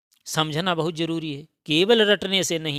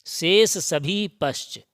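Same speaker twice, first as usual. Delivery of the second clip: शेष सभी पश्च said very slowly, word by word; शेष सभी पश्च, slow and deliberate so students note it; slow emphasis; much slower than usual. Shown as a demonstration. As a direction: drive home शेष सभी पश्च at half speed. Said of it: "ये लिखा है, शेष सभी पश्च हैं".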